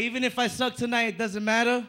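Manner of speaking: usual speaking voice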